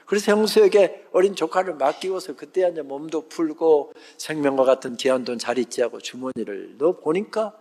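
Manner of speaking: ordinary talk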